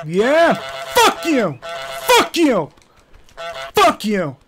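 high, loud gloating shouts; taunting yell. Real, not acted. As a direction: yell it in triumphant defiance. Taunting the gardener as goose.

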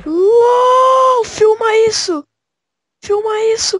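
high, thrilled exclaim; excited amazement. Real, not acted. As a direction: gasp with wonder at the sight. Descoberta surpreendente no jogo. (espanto animado)

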